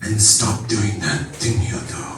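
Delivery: hushed, weighty instruction, imitating dad; low uneasy order. Dad telling his son to stop, low and hushed. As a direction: drop low, slow and soft.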